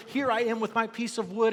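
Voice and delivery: high, loud, proud of his little offering; all excited. Acted. Newcomer showing his wood to the carpenter, excited. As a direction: burst in eager and pleased. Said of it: "And he's all excited".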